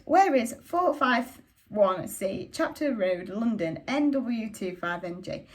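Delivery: slow, each part spelled out; slow deliberate address; slow careful asking. Acted. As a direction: read out the address slowly and carefully.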